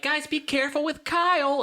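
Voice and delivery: high fussy mom. Retold or imitated. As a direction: warn like a worried mom. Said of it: "mom would be like".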